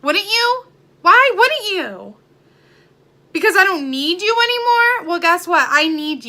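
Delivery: heated, high and loud challenge; mad. Real, not acted. Vlogger confronting people who begrudge her happiness, mad. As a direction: demand an answer, voice pushed up in real anger. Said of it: "I'm getting mad".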